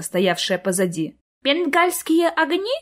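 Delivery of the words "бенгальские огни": put-on high, slow little girl's voice; curious small voice; questioning child. A narrator's reading. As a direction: ask slowly for clarification as a child. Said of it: "уточнила Сонечка".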